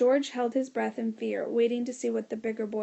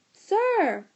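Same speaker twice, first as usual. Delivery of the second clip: a high, loud yell of sir; 9 semitones higher, 6 dB louder than usual. A narrator's reading. Boy grabbing a teacher, yelling sir. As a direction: yell to grab the teacher's attention. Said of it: "speaking in a very loud voice".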